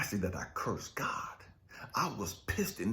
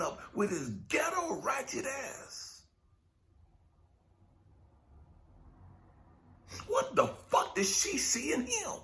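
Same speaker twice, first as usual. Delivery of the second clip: rising into a raw, pitched-up shout; angry outburst; 11 semitones higher than usual. Real, not acted. Preacher cursing his mother's choice, rising shout. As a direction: yell it out, voice cracking with hurt.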